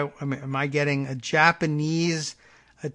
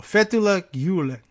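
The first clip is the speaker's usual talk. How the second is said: FETHULLAH GULEN as "FET-oo-la GYOO-len", first syllable punched higher; raised, emphatic FET at the start of FETHULLAH GULEN; first-syllable stress; 4 semitones higher, 4 dB louder than usual. Shown as a demonstration. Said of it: "emphasis on the first syllable".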